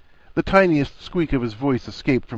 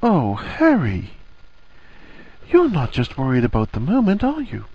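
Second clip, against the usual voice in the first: Hermione, dawning sympathy; 5 semitones higher than usual. A narrator's reading. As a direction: stretch the name, then ask tenderly. Hermione realizes Harry's deeper worry.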